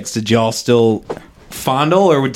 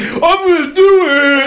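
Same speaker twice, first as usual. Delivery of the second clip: much higher, louder, slow fake-crying wail; mock suicidal whine; 4 dB louder than usual. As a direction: ham up a sobbing drama queen. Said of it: "This is people like".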